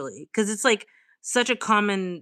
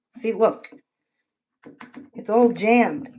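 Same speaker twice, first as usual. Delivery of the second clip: normal talking voice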